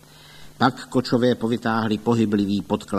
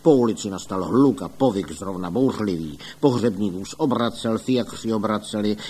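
ordinary delivery, nothing marked

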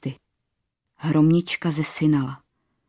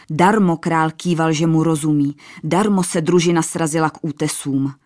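usual speaking voice

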